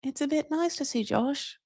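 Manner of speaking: high, soft imitation English accent; mock English understatement in an English accent. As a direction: quietly put on a reserved English accent. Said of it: "it's so edited to be".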